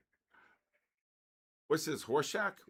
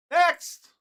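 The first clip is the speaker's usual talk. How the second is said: sharp loud call, higher pitched; shouted next; 12 semitones higher, 10 dB louder than usual. Real, not acted. Streamer shouting for the next viewer, loud and high. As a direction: bark it out to the whole room.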